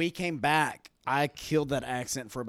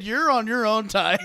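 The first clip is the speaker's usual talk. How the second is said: louder and higher, retelling through laughter; loud retold send-off; 8 semitones higher, 8 dB louder than usual. Wingmen leaving him on his own, loud mock call. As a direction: call it out as the friends cutting him loose.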